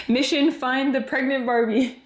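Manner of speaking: giggly mock mission announcement; laughing mission call. Real, not acted.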